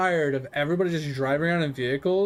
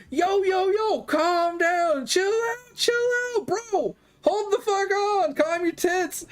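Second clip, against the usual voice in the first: much higher, pleading voice stretching out the words; panicked player begging; slower than usual. Retold or imitated. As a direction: mimic a scared guy begging you to stop shooting. Victim player begging him to stop.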